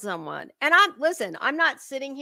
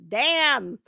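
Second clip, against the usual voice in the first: usual speaking voice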